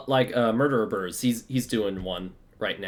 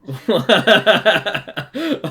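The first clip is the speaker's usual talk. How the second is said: loud burst of laughter; 9 semitones higher, 10 dB louder than usual. Real, not acted. Reacts to a your-mom joke.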